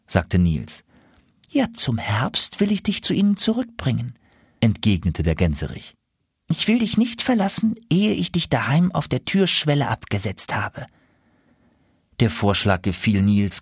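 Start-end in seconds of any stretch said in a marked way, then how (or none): 1.5-4.1 much higher goose voice; high, earnest gander promise. Acted. 6.5-10.8 much higher goose voice; high, earnest gander promise. Acted.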